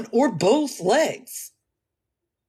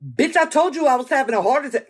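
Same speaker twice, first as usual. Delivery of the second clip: louder, higher, furious snap; angry retort; 8 semitones higher, 6 dB louder, faster than usual. Retold or imitated. Angrily reminding the doubters she said heart attack. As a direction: tell them off for not believing you.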